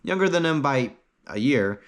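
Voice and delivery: ordinary delivery, nothing marked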